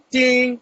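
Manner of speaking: high, loud, ringing "ting"; imitated notification ding. Host mimics a message alert, high loud ding. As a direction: sound the alert in a high, held note.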